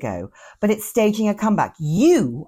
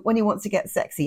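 ordinary talk

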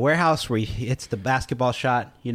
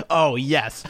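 imitating Kevin. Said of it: "Kevin goes".

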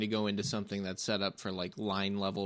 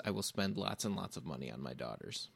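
ordinary talk

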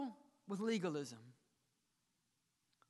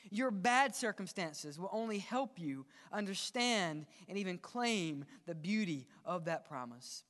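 usual speaking voice

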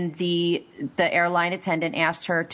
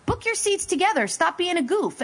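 high-pitched exasperation; exasperated scolding; 9 semitones higher than usual. Real, not acted. Host scolding the dad, high exasperated telling-off. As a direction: tell him off as if it's obvious.

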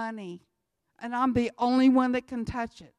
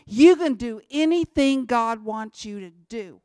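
much louder and punched, plain and blunt; forceful conviction; 8 dB louder than usual. Shown as a demonstration. Speaker giving his own blunt version, loud and forceful. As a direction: hit it hard like you mean it. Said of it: "but to me".

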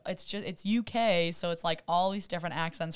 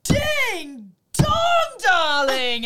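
high, loud, drawn-out catchphrase; catchphrase impression; 11 semitones higher, 11 dB louder, much slower than usual. Imitating her signature call for friends, high theatrical shout. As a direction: belt it out like a showy TV judge's call. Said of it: "which means she goes".

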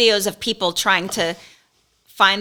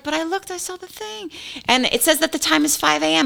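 higher, pleading mock protest; exasperated protest; 7 semitones higher than usual. Retelling arguing with the tennis guy, higher exasperated protest. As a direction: play yourself confused and put-upon. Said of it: "And I was like".

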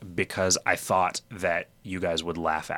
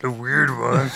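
weird dinosaur voice; 3 semitones higher, 3 dB louder, much slower than usual. Pretend. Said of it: "is that what a dinosaur sounds like".

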